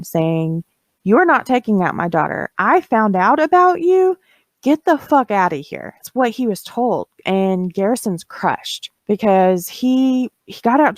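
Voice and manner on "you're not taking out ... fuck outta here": higher-pitched, mock-angry father's outburst; retold angry screaming. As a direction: imitate an irate dad ordering someone away, without really screaming. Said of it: "he starts screaming at Garrison".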